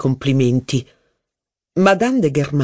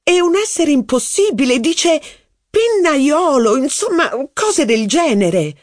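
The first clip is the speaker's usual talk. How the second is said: duchess holding the word at arm's length; mocking duchess; 12 semitones higher than usual. A narrator's reading. Duchess quoting slang word.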